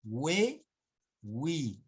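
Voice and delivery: "We, wi" with a W glide; U sounded. Shown as a demonstration. Teacher demonstrating the sounded U to class. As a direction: stretch we and wi so the U is heard. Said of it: "Acá sí".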